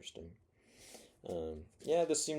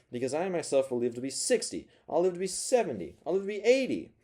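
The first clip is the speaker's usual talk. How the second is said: building defiance; 6 semitones higher than usual. Acted. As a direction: boast harder on every age until it peaks. Narrator's defiant boast escalating.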